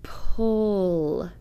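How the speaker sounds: drawn-out Pull; sounded out slowly. Shown as a demonstration. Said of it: "The exception word should be read like this".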